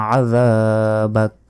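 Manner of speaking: "আযাবাক" slow, stopping on a final k; থেমে পড়া. Shown as a demonstration. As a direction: stretch "যা", then close "আযাবাক" on k without a vowel. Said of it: "যদি থামি তাহলে বলতে পারি". ("আযাবাক" টেনে, শেষে ক-তে থেমে)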